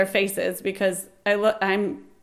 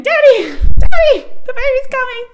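high, loud re-enacted scream; 15 dB louder than usual. Woman in labor to her husband in the speeding car, screaming. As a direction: scream it out in panic for Daddy. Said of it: "and screaming".

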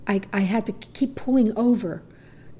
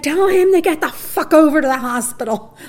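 furious, higher and louder; angry demand; 7 semitones higher, 6 dB louder than usual. Retold or imitated. Wife yelling at husband to phone the doctor, angry and louder. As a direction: shout the order at him. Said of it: "I was so angry".

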